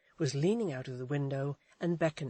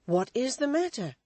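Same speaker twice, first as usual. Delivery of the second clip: cried out; 9 semitones higher, 6 dB louder than usual. A narrator's reading. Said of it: "cried Walter".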